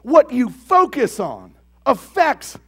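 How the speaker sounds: loud, slow, emphatic preaching; fervent sermon climax, loud and deliberate; preaching at full force. Real, not acted. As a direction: drive the point home to the congregation.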